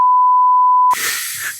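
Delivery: high squealing laugh; shrieking laugh. Real, not acted. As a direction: burst into a shrill cackle.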